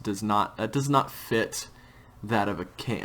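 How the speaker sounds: ordinary talk, nothing marked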